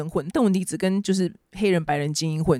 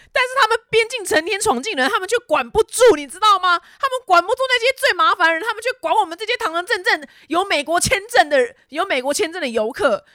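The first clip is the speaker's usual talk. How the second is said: much higher, heated outrage; indignant rant; 11 semitones higher, 3 dB louder than usual. Real, not acted. Vlogger ranting at US officials, high indignant voice. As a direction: rail at the border officials, pitched way up.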